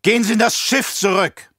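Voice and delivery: loud urgent roar. Acted. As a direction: bellow a warning to the emperor. Officer keeps shouting.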